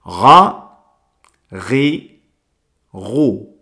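Ga, ri, ru with a throaty ghain, each syllable slow and apart; ga ri ru with a gargled R; ghain sounds. Shown as a demonstration. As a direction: demo the ghain syllables one by one. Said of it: "se pronunciaría la letra ghain de la siguiente manera".

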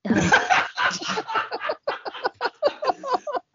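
high cackling laugh; long laugh. Real, not acted. Host cracks up.

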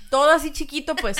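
usual speaking voice

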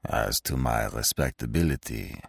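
low, quiet, dry retort. Acted. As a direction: say it dryly, low and cool. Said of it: "said the stranger dryly".

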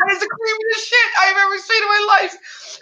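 high, shrieking mock yell; mock shout. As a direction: shriek it out like you're freaked. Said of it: "I'm like".

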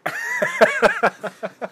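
loud high burst of laughter. Real, not acted. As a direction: crack up laughing. Laughs hard at own story.

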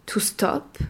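British stop; "stop" with a round O; British English. Shown as a demonstration. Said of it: "se dira en anglais". (en anglais britannique, "stop" avec un O rond)

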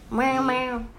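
string of playful cat meows. Acted. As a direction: meow like a cartoon cat. Cat character meowing again.

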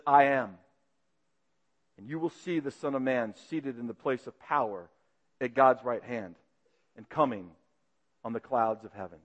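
quiet certainty, every word weighed; humble and bold. Acted. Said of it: "very humbly, very boldly".

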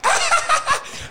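high squealing laugh; a short, high-pitched giggle. Real, not acted. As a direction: let out a high cackle of delight. Laughing at own joke.

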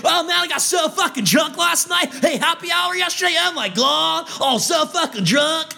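put-on high, shouty bro bragging about getting drunk; mock party bro. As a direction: brag like a dumb partier. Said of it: "no one wants to hear".

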